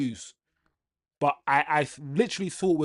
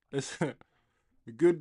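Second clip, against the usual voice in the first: laughing; much slower than usual. Real, not acted. Laughing at chat joke.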